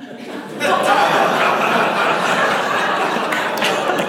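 long airy high laugh; high burst of laughter. Real, not acted. Stunned by the cab driver's question, laughing hard. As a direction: break into real helpless laughter.

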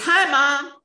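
high, chirpy mock-daughter greeting; breezy, carefree. Daughter phoning mom as if nothing had happened, breezy imitation. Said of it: "like nothing had happened".

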